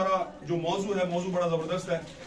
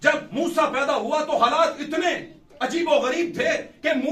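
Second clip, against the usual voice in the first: fervent preaching; 4 semitones higher, 4 dB louder than usual. Acted. Preacher retells Moses story.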